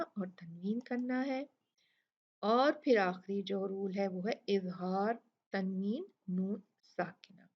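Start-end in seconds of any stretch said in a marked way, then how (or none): none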